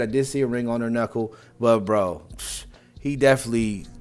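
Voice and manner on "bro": ordinary talk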